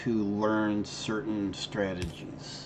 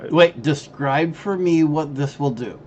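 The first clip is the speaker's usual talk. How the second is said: loud teasing; 6 semitones higher, 10 dB louder than usual. Real, not acted.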